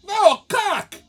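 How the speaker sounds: high, loud mimicked Spanish-accented correction. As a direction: insist loudly as the frustrated bartender, Spanish accent. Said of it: "this woman saying cock at me".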